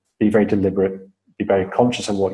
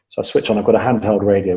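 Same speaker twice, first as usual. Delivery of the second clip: ordinary talk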